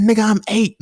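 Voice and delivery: put-on very high, loud squeaky kid; high squeaky little boy protesting; Riley voice. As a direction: shriek it like an indignant eight-year-old. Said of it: "He said".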